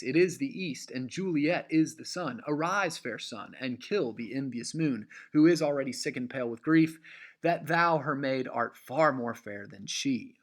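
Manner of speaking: verse demo with lifted stresses, as in "aRISE, fair SUN"; iambic pentameter. Shown as a demonstration. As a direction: recite in a rolling da-DUM beat, "it IS the EAST". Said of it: "Shakespeare's iambic pentameter".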